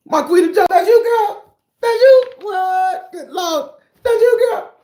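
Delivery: very high, loud squealing sing-song; squealing tease. Real, not acted. Streamer teasing a viewer about her name, squealing high and loud.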